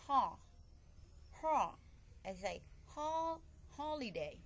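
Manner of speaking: "holiday" with its first syllable pitched high; stress on first syllable. Shown as a demonstration. Teacher showing word stress, "holiday" stressed on HO. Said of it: "raise up my, my voice".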